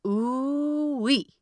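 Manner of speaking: high mocking ooh-wee from a crowd of gremlins; judgy gremlin whoop. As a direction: tease like a gloating little critter, lightly voiced. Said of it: "and they're all like".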